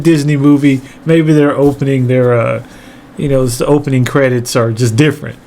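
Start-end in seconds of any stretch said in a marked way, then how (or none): none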